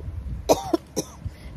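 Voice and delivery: sharp dry cough; coughing from dust in the lungs. Real, not acted. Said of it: "dust in my lungs".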